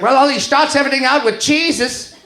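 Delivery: put-on high, insistent German accent; German mom. German mom explaining her proof to her son, high German accent. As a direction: pitch it up as an exasperated German mother. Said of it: "my mom is German".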